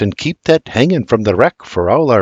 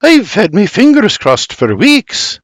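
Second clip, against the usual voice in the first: much higher, slowed 'Tis perfect, chirpy Scots; cheerful mother, higher and louder; delighted mother; 9 semitones higher, 3 dB louder than usual. Acted. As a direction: lighten the mood with cheer. Berta lightens the moment.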